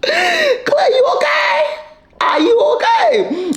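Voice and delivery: high, anxious calling out to him; mock worried call. Host calling to Clay on screen, high worried cry. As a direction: fret over him in a high, tearful cry.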